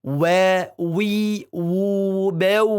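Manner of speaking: "Wa, wi, wu, bew" with pushed-out rounded lips; pursed waw chant; rounded waw drill. Shown as a demonstration.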